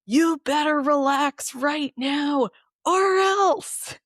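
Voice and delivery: high, slow, drawn-out mock yell; goofy cartoon yell. Goofy cartoon character bullying her into relaxing, yelling. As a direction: bellow it like a cartoon character trying to sound scary. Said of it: "it was yelling at me".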